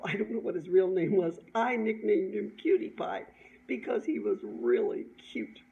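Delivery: ordinary delivery, nothing marked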